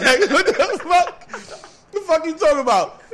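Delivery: high, loud retort through laughter; laughing disbelief. Retold or imitated. Storyteller replaying his disbelief at the stripper, laughing. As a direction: push it up high while cracking up.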